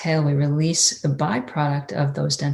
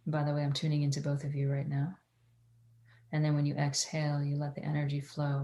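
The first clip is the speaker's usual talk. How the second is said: soft, hushed meditation guide; airy breathy mystical healer; 9 dB quieter than usual. Acted. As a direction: guide them inward, softer.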